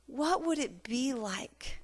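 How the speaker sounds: very soft, with long pauses; hushed invitation. Real, not acted. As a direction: gently draw listeners into imagining. Inviting listeners to imagine, hushed.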